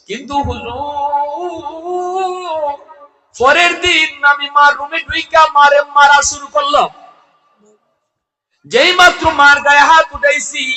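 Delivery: high, loud chanted confession, line endings held long; full-force preaching. A narrator's reading. Son confessing to the cleric he struck his mother, high preaching chant. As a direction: cry out the tale in soaring sermon chant.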